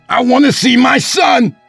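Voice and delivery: high, loud desperate shout; angry demand. Acted. Estranged father demands his son, desperate shout. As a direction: bellow your demand through the house.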